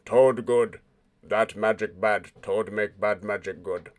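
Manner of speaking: low monotone creature voice; flat, cut over them; loud monotone. Acted. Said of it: "loud, monotone voice cuts over".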